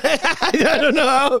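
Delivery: high, drawn-out laughing words. Real, not acted. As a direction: stretch it out while laughing. Giggling over word plurals.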